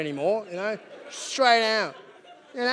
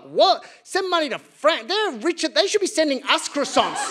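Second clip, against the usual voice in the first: higher, incredulous; indignant public; 7 semitones higher than usual. Retold or imitated. Ordinary people scoffing at aid for France, higher pitch. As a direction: scoff at the idea. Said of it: "people were like".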